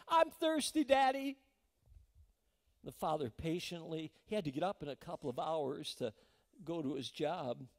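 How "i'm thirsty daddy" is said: put-on high, loud child's call to her daddy; little girl calling. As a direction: cry out like a thirsty little girl at night. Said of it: "She called her daddy".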